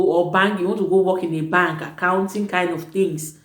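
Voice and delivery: normal talking voice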